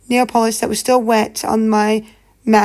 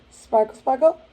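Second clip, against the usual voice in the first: sign-off catchphrase; 6 semitones higher, slower than usual. Real, not acted.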